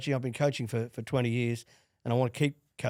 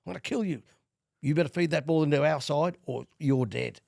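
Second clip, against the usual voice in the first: higher-pitched, mock-angry retold threat; 4 semitones higher than usual. Tommy threatens him over the scrum feed, retold as angry. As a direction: imitate an angry player warning a teammate. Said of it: "Like, really angry".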